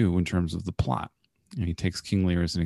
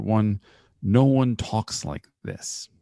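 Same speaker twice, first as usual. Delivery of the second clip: usual speaking voice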